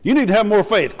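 a hint of a stern, nagging God; harsh scolding God. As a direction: scold like an impatient judge. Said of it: "they see God is standing back there and say".

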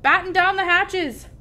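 high, loud storm command; urgent captain's holler; shouted order. A narrator's reading. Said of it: "Braidbeard shouted".

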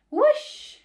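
very high, very loud squawk; chicken shriek. A narrator's reading. The chicken shooting into the air, shrieking very high. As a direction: shriek like a launched bird. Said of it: "shrieked the chicken".

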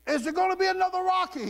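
high mock interviewer voice; a bright, high TV host. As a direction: pitch it way up like a chirpy host. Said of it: "the guy interviewing him said".

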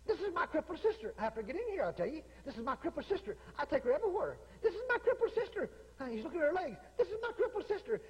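high, laughing mock of a sneaky teen boy; mocking boy's excuse. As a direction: squeak it out like a kid making excuses, half laughing.

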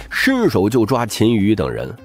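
ordinary delivery, nothing marked

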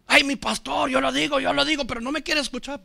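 high, run-on imitated griping; mock complaining congregant. Congregant complaining that pastor won't listen, high mock lament. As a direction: play a congregant moaning about being unheard.

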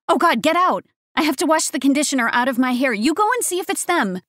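high, loud, fast female panic; panicked. A narrator's reading. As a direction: rush it, freaking out. Woman panicking as parents arrive.

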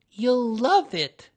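timid little helper chiming in; Piglet voice. A narrator's reading.

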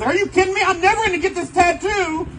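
high, loud hammed-up complaint; mock selfish outrage. Young him about the lost tattoo, high loud mock outrage. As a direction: play your younger self whining. His first thought, exaggerated.

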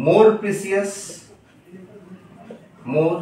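ordinary talk

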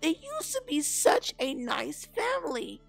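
high, loud cartoon bunny voice; shocked bunny. A narrator's reading. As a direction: exclaim like an appalled little rabbit. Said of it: "said Mister Bunny".

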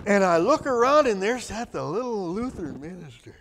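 usual speaking voice

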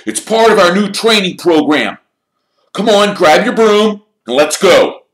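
high, loud, hearty team captain; rally the team with gusto. A narrator's reading. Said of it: "said Wood heartily".

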